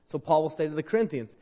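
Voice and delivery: ordinary talk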